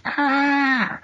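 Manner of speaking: short high monster shriek; mock Godzilla screech. Retelling the sitcom bit, high Godzilla screech. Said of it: "They're all doing Godzilla impressions".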